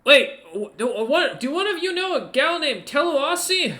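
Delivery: high, loud startled stammer; startled ogre. Acted. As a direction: stumble over the words, amazed.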